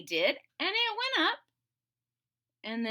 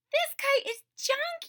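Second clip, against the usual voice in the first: high, glum complaint; Toad's voice. Acted. Toad telling Frog he gives up, high glum moan. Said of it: "he said".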